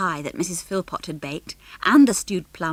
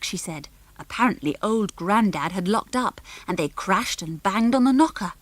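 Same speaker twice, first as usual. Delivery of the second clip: ordinary delivery, nothing marked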